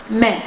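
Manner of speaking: mère with open è and throaty r; short, low French mère with a grave-accent è. Shown as a demonstration. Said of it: "más abierta".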